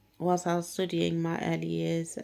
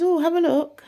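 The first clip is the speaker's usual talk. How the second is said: high, loud coaxing; imitating the eager teacher, higher and louder; eager urging; 12 semitones higher, 10 dB louder than usual. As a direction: urge her to look now. Teacher urges her.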